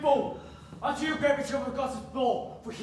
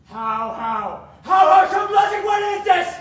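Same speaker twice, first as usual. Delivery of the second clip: furious shout; 6 semitones higher, 10 dB louder than usual. Acted. Father enraged at refusal.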